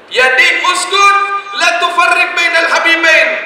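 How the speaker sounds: high, slow chanted Arabic; intoned Arabic plea. Acted. Scholar begs the dawn to stay silent, high chant. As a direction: cry it out in a rising chant.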